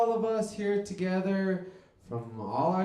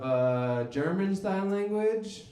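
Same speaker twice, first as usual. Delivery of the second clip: ordinary talk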